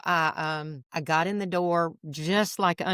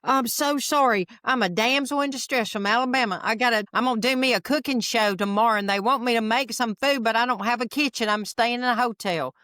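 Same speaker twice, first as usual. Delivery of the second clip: higher-pitched, hammed-up Southern damsel; put-on Southern damsel in distress, pitched up; best Southern accent; 5 semitones higher than usual. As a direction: plead sweetly in a thick Southern accent. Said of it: "put on my best Southern accent".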